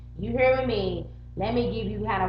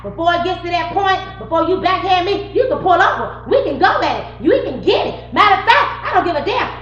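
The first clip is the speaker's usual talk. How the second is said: louder and higher, daring him; reliving a heated threat; 8 semitones higher, 8 dB louder than usual. Retold or imitated. Retelling how she dared her boyfriend to pull over, heated and loud. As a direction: throw down the challenge again.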